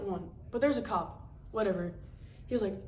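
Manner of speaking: ordinary talk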